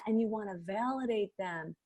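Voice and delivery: usual speaking voice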